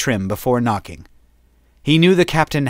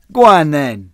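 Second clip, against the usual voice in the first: taunting; 4 semitones higher, 6 dB louder than usual. Acted. Zane taunts after laughing.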